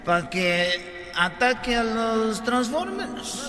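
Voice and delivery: very slow, soft official speech; presidente pausado. Acted. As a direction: announce it haltingly, low and unhurried. Parodia de presidente de habla pausada. (presidente de habla lenta y pausada)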